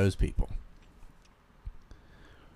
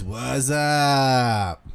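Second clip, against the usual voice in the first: higher, long stretched-out catchphrase; drawn-out greeting; 4 semitones higher than usual. Retold or imitated. Streamer voicing a viewer's greeting, drawn-out call. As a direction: stretch it like a goofy holler.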